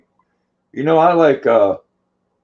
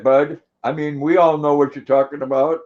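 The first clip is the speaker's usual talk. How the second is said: usual speaking voice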